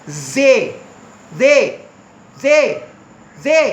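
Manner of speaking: "जे" with a buzzed th, UK accent. Shown as a demonstration. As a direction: say "जे" as separate UK-accent model words. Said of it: "UK accent".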